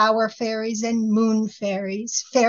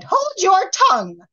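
higher, louder rude snap; 11 semitones higher, 6 dB louder than usual. A narrator's reading. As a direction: cut her off rudely, pitched high. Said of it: "most rudely".

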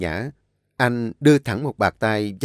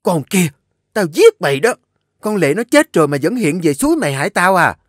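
shrieking louder and higher; screaming rage; 8 semitones higher, 7 dB louder, faster than usual. A narrator's reading. An Nhã screams at the accusing maid, enraged. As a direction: scream the threat. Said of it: "An Nhã gào lên".

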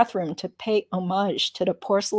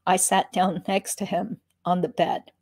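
plain reading voice

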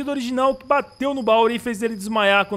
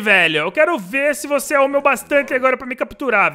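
triumphant taunt; 5 semitones higher, 7 dB louder than usual. Real, not acted. Taunting after locking door.

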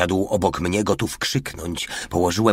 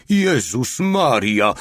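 high, loud, drawn-out exclamation; sudden cry; 6 semitones higher, 5 dB louder, much slower than usual. A narrator's reading. Lookout spotting the enemy, crying out suddenly. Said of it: "krzyknął nagle mały".

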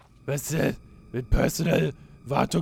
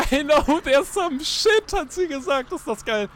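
squealing amusement; 13 semitones higher, 5 dB louder than usual. Real, not acted. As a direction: quote it in a delighted high squeal. Laughing at game's spoken line.

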